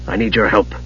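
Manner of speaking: low, soft-voiced commanding mage; quiet order. A narrator's reading. Raistlin telling the kender he is needed, commanding. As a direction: order it without raising the voice. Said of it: "Raistlin ordered".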